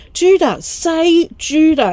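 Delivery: put-on high, loud, slow child's "say Judah"; a small boy bossing his brother; imitating an insistent little boy; big brother insisting. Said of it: "Asher's like".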